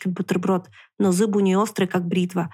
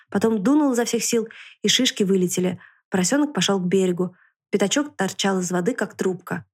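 ordinary delivery, nothing marked

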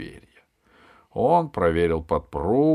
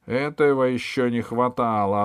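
annoyed, raised and louder; angry; 5 semitones higher, 5 dB louder than usual. A narrator's reading. Valet objects to the boy's claim, angry. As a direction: protest with rising irritation. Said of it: "озлился камердер".

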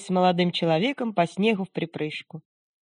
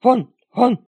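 gruff barking yelp; barking dog; 5 semitones higher, 7 dB louder than usual. Acted. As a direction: bark it out like a chained dog. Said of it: "пролаяла цепная собака".

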